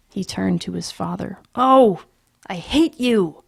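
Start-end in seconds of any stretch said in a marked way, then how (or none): 1.5-3.4 high, loud angry outburst; furious child. Acted. Peter turns on his father, loud and furious. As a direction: spit hatred at your father.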